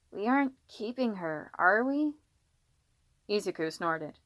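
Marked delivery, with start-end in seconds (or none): 0.1-2.1 put-on little boy's voice. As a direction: ask it like a doubtful little brother. Little boy objects to the baby.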